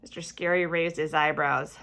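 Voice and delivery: ordinary delivery, nothing marked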